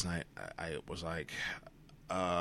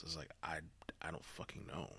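whisper; 8 dB quieter than usual. Real, not acted. Frustrated aside, drops to whisper.

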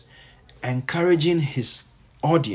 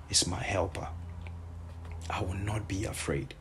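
hushed, breathy whisper, softer; 5 semitones lower, 10 dB quieter than usual. Acted. Preacher to his congregation, repeating the verse in a soft whisper. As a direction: breathe the verse like a secret promise.

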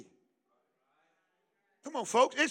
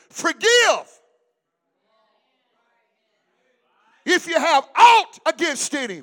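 loud, rising preacher's cry; preaching at full force; 3 semitones higher, 8 dB louder than usual. Acted. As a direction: drive the scripture home to the church.

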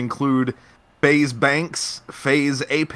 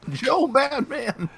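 amused; 12 semitones higher, slower than usual. Real, not acted. Repeats joke, amused.

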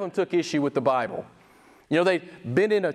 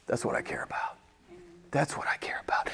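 hushed whisper; 8 semitones lower, 11 dB quieter than usual. Real, not acted. Preacher to congregation, repeating his point in a whisper. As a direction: drop to a whisper, much softer. Preacher stresses caring only for God.

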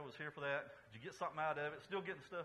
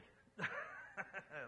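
laugh. Real, not acted. Pastor laughs before his joke.

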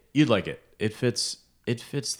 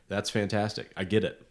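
ordinary talk, nothing marked

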